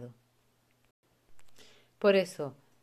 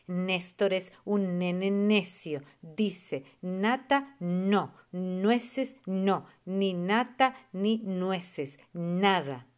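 slow, firm chant, each N pressed out; slow rhyme recital; 3 dB louder, much slower than usual. Shown as a demonstration. Teacher demonstrating the N rhyme, slow and firm. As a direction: sound out every N slowly and clearly. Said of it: "la rima de la N, por ejemplo, es".

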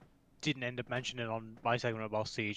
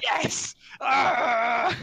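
triumphant shout; 11 semitones higher, 8 dB louder than usual. Retold or imitated. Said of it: "And I'm like".

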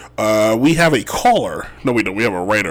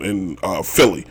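usual speaking voice

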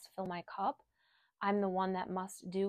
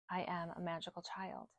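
the affirmation repeated much softer; softer echo; 10 dB quieter than usual. Shown as a demonstration. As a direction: echo it back quietly, like a repetition. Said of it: "I want you to repeat these affirmations".

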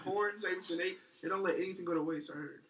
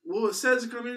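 lightly higher, louder retold reply; mock driver; 8 semitones higher, 11 dB louder than usual. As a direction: answer back with mock indignation. Truck driver replies, retold.

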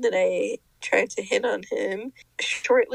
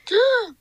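loud, sharp intake of breath; delighted gasp; 10 semitones higher, 8 dB louder than usual. Real, not acted. Reacting to co-host's mushroom comparison, surprised gasp. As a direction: gasp at a sudden recognition.

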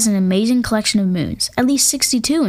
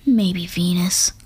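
whispered aside; 3 semitones lower, slower than usual. Acted. Quiet aside after praising Saturn.

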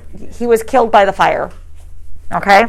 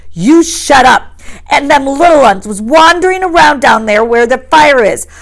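louder, higher snapping retort; Jack snapping; 7 semitones higher, 5 dB louder than usual. A narrator's reading. Jack turning on Piggy, angry. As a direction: shut him down hard.

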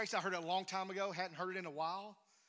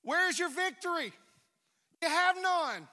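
higher, louder defiant challenge; loud taunt; 8 semitones higher, 7 dB louder than usual. Acted. Preacher challenging death before the congregation, loud and triumphant.

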